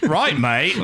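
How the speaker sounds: laughing through a quick mock Aussie accent. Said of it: "do the whole show in an Aussie accent".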